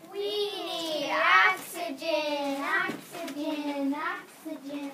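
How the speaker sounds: stretched, loud insistent cry; loud slow insistent repetition; loud slow plea. Acted. Creature repeats plea.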